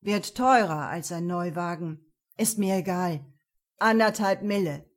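loud, smug goat-like mechanic; sly goat man voice. Acted. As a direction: state the price with sly relish. Mechanic names steep price. (schlau, laut, Ziegenmann)

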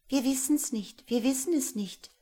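high, loud little bird chirps; piping chorus of birds; twittering birds. A narrator's reading. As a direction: chirp it like songbirds. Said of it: "zwitscherten die Vögel".

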